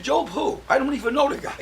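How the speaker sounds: high, incredulous "Job who?"; mock bewilderment; baffled. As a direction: act totally clueless. Baffled reply about Job.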